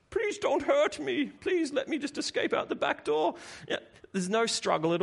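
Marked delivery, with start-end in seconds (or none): 0.1-3.4 very high, hammed-up comic whimper; Vader pleading for mercy. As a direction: beg in a squeaky mock-coward voice. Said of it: "Vader is there in all his terrifying power".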